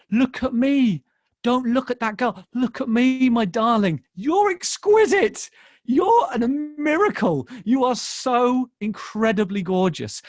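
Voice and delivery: loud, high, emphatic adoration; impassioned fatherly affirmation. Retold or imitated. Father turning his daughter's eyes from the other girl, fervently affirming. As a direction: reenact a dad pouring praise on his girl, stretching the key words. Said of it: "as I said to my daughter".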